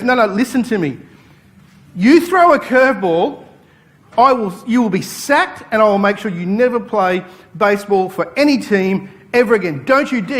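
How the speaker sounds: coach's threat, rising high and loud; angry coach. Retold or imitated. Coach threatening his pitcher before the last strike, high loud angry voice.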